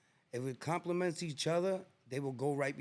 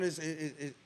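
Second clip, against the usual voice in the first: usual speaking voice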